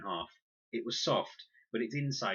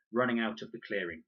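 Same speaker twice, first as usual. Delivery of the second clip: ordinary delivery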